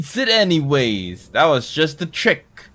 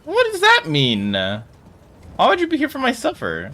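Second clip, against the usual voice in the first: incredulous; 10 semitones higher than usual. Real, not acted. Incredulous at chat.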